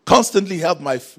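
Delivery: loud, slow cry of Lord, I believe; loud tearful cry of the boy's father; crying plea. Retold or imitated. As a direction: plead through tears. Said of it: "gave a cry with tears".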